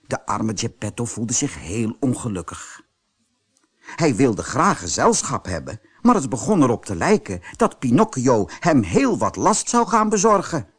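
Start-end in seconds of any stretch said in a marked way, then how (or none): none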